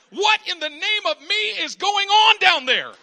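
loud, high, comic exasperation; exasperated God. Retold or imitated. God calling down to people, loud and exasperated. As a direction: shout down from heaven, fed up. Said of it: "God is saying".

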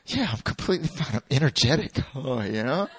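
mock-energetic cover-up; overdone denial. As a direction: insist you are totally fine. Acting exaggerated denial to a friend.

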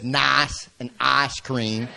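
lightly voiced southern drawl on nice and ice cream; a hint of his wife's southern nice and ice cream; southern accent. Shown as a demonstration. Pastor imitating his wife's southern words, mild southern accent. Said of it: "instead of saying nice or ice cream".

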